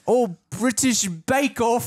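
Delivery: high, loud, slow London caricature; mock London accent. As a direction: call the name out as a squawking cartoon Londoner. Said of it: "you sound like you're from London".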